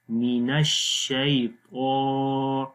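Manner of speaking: slow drill of الشیطان with a long, thick 'taa'; long, heavy vowel. Shown as a demonstration. Teacher showing a student the long vowel, الشیطان stretched heavily. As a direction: draw out the last vowel of الشیطان, full and heavy. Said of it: "لمبا بھی کریں گے، موٹا بھی پڑھیں گے".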